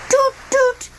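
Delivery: high sung toot like a train whistle. A narrator's reading. Train whistle sound in story.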